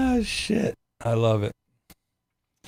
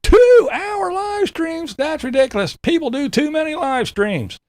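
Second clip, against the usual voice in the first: whiny mocking voice. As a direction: wail it like a sulking baby. Continuing whiny parody.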